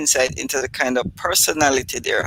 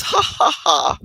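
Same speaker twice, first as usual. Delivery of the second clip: imitated laughing; 2 semitones lower than usual. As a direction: laugh out like mama. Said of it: "laughing like this".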